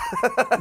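a short high laugh at his own story; burst of laughter. Real, not acted. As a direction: crack up at the awkward memory.

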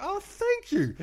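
very high, loud, gushing thanks; sing-song mock-touched thank you; exaggerated gratitude. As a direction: play it sweetly flattered, hammed up. Acted grateful reply to praising wife.

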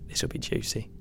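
unvoiced whisper on "This will be juicy". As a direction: breathe "This will be juicy" like gossip. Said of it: "This will be good".

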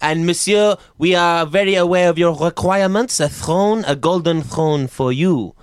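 courteous French maître d'; hesitant, polite French host; French waiter. Acted. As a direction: welcome guests in a French accent. Plays French restaurant host.

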